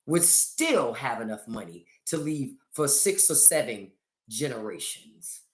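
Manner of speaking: normal talking voice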